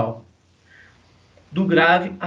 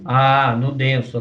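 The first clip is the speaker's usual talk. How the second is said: ordinary talk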